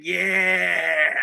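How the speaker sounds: long drawn-out, self-satisfied "yeah"; grinning, pleased dad. Acted. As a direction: draw it out through a big grin. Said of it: "got that big grin on his face".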